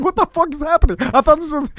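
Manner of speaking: high-pitched mock outburst; retold yelling wife. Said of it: "she's yelling at me".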